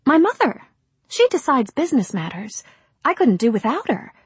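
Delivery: put-on high, bright young actress. Rosemary speaks.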